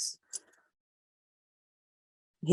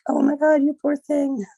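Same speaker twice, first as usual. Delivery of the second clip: much higher, louder gushing pity; mock sympathy; 11 semitones higher, 4 dB louder than usual. People reacting to her Facebook posts, high mock gushing sympathy. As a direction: gush fake pity like a Facebook commenter. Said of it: "people to be like".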